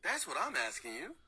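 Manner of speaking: ordinary talk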